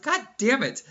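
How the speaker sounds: half-joking outburst at the theater guy; frustrated curse. Retold or imitated. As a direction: blurt it out in mock exasperation. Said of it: "I was like".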